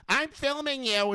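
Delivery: high, slow, stretched-out mocking voice; mock Karen. Mocking people who film police, high drawn-out whine. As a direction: whine it up high like a meddler with a phone. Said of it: "these people that are like".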